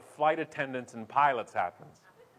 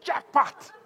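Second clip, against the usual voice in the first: high, loud cry of triumph; gleeful jackpot; 11 semitones higher, 7 dB louder than usual. Acted. Speaker delighted at getting his story, high loud glee. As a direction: crow it as if you just won.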